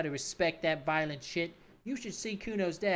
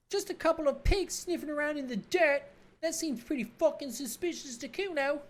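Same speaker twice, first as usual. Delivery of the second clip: put-on bratty kid Kuno; 11 semitones higher than usual. Cocky kid mocking the cop, in a high bratty voice. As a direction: sneer it like a cocky street kid.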